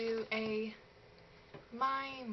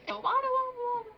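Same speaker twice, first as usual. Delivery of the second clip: high fast wada; 11 semitones higher, much faster than usual. Shown as a demonstration. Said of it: "That's the noise they make".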